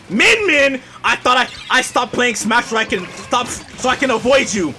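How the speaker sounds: exasperated, high-pitched tirade; livid rant. Real, not acted. Player ranting at Min Min who followed him, livid. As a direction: rage at an enemy who keeps coming back. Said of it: "I'm livid".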